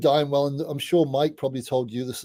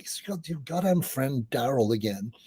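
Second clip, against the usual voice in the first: softer, fed-up mock wife voice; exasperated wife; 5 dB quieter than usual. Wife grumbling about the late-night call, fed-up and softer. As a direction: sigh it out like a tired wife at 4 a.m.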